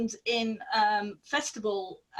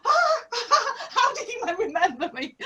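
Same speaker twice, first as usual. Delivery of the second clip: much higher, laughing squeal of delight; laughing, higher: amazed the star remembered her; delighted disbelief; 12 semitones higher, faster than usual. Retold or imitated. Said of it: "I was like".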